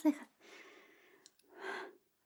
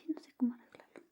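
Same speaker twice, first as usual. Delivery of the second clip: whisper; 4 semitones lower, 7 dB quieter, faster than usual. Acted. ASMR whisper roleplay.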